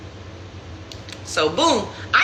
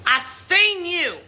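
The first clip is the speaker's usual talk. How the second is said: slow insistent I-saw-you, pitched up; confronting him; 6 semitones higher, slower than usual. Retold or imitated. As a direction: spell it out to the cheater slowly. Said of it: "And I was like".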